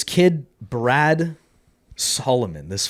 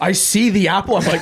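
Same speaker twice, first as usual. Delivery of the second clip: normal talking voice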